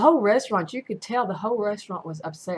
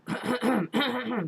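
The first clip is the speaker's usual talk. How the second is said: pointed, repeated throat clearing; imitated throat-clearing; 4 semitones higher than usual. Imitating an annoyed elderly diner, pointed throat-clearing. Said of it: "he kept doing that".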